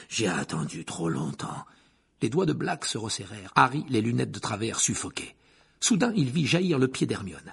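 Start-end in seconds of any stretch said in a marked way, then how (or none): 0.1-1.6 low, slow hissed line; a low menacing hiss. Acted. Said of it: "dans un sifflement".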